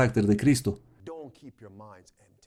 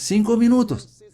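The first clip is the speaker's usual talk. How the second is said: "Cinco minutos" jumps far higher, leaning on "minutos"; sudden high emphasis; 9 semitones higher than usual. Real, not acted. Preacher stresses how short five minutes are, with a sudden high leap. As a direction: fling "minutos" up high to drive it home. Preacher repeats the time span.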